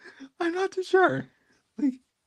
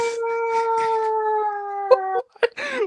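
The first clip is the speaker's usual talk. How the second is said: long high falling scream. Acted. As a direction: scream off the top of the voice. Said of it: "send him over screaming".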